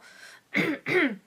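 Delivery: loud throat clearing. Real, not acted. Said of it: "J'ai un truc dans la gorge".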